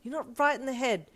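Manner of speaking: loud, high disbelief; protest, raising your voice; flustered accusation. A narrator's reading. Flustered accusation after compliments.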